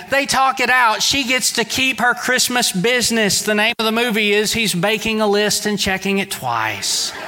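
ordinary talk, nothing marked

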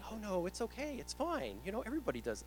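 put-on high, soft, reassuring old lady; imitated sweet phone-line granny; sweet little old lady. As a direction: soothe the caller like a kindly old lady. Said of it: "This sweet little old lady".

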